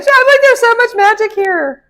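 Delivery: high, loud gushing; excited. Real, not acted. Reader marveling at the tarot spread, loud and high. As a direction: burst with wonder at the magic.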